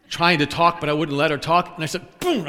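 ordinary talk

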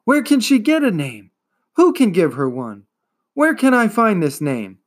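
high, loud desperate questions; urgent loud pleading. A narrator's reading. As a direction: beg for an answer loudly. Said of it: "cried Atreyu".

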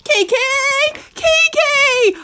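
high desperate call; crying out. A narrator's reading. Pig calling for Kiki while running, rising desperate call. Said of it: "I cries".

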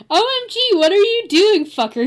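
high, loud hammed-up outrage; mock angry shout. A narrator's reading. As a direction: burst out in overplayed anger. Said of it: "he shooted angrily".

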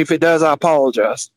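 usual speaking voice

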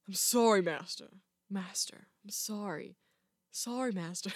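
low, hushed Jedi apprentice. Acted. Apprentice apologizing to his master, low and hushed. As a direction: apologize softly, sinking toward a whisper. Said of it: "not that much of a teenager".

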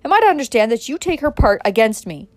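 loud, indignant challenge; shouting. A narrator's reading. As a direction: thunder at a disloyal wife. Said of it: "shouted Adam".

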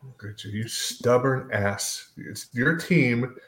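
ordinary delivery

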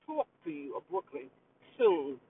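lightly done Brooklyn New York accent. Shown as a demonstration. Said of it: "in my New York accent".

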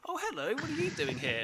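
high-pitched mum hello; imitated surprised greeting. Mum spotting an acquaintance from behind, imitated surprised greeting. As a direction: mimic a chirpy, high hello.

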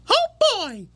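very high cartoon squeak; Mickey Mouse. Dad showing his daughter's one joke, Mickey Mouse squeak. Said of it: "do the Mickey Mouse voice".

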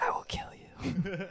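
slow unvoiced whispered death threat; the frat bro whispers his final threat, slow. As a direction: hiss it slowly, menacing. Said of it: "whispering about fighting each other".

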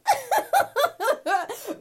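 loud high-pitched laugh. Real, not acted. Signing off the episode with a loud high laugh. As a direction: laugh it off to close.

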